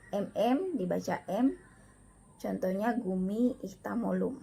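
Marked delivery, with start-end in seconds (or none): none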